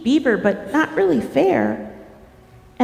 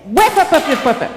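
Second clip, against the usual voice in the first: beaver tail slapping; faster than usual. Acted. Storyteller repeats the tail noise for listeners, imitated slapping. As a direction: run of percussive whaps, falling away. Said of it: "what sound does Beaver's tail make?".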